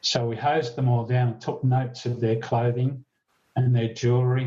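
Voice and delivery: ordinary talk, nothing marked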